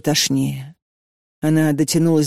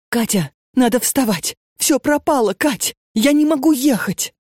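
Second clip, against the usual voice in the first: high, frantic, on the edge of tears; a voice cracking with panic over lost plans; panicked plea; 7 semitones higher than usual. A narrator's reading. Panicking roommate wakes Katya at dawn, frantic and high.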